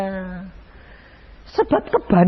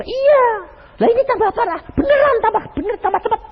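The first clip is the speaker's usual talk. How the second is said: emphatic pastor reply; faster than usual. Retold or imitated. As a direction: insist brightly it's worse. Pastor-patient dialogue voiced.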